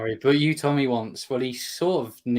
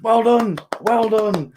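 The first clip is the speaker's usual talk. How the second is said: high loud sudden shout of well done; hammed-up shouted praise; mock shouting guy; 10 semitones higher, 8 dB louder, much slower than usual. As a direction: yell it like the random shouter.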